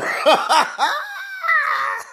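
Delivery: a high shrieking burst of laughter; real helpless shriek of laughter. Real, not acted. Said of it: "I cannot stop laughing".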